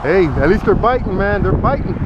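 usual speaking voice

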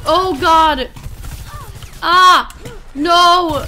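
loud panicked yelps; panicked shouts under attack; scared giddy laughing. Real, not acted. Panicking in fight.

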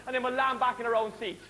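normal talking voice

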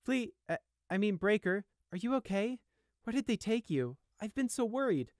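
ordinary delivery, nothing marked

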